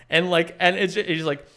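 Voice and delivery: ordinary delivery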